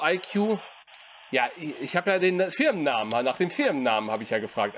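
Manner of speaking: ordinary talk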